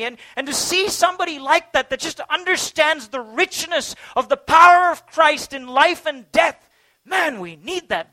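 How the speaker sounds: fervent sermon climax, loud and high; impassioned preaching. Acted. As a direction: preach it with rising fervour. Preaching full force about dying.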